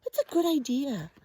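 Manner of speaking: ordinary delivery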